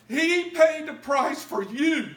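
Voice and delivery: He paid the price for you, preached high, loud and very slow; full-force preaching. Acted. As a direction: stretch every word of He paid the price for you and shout it. Preached peak then repeated plainly.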